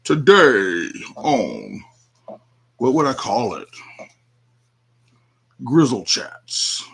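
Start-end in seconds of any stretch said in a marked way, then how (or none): 0.0-1.8 slow, louder show-intro voice; mock podcast announcer, drawn out; podcast host voice. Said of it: "I have the voice for it". 5.6-6.8 slow, weighty show title; mock announcer naming the podcast; podcast host voice. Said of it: "I have the voice for it".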